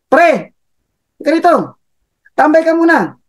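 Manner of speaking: high, lightly voiced coworker coaxing; buddy urging. Coworker tempting employee to stay out, high coaxing. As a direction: coax your pal to hang out.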